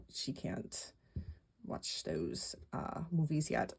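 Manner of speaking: hushed, breathy aside; whispered. Real, not acted. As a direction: drop to a whisper. Aside about daughter's viewing.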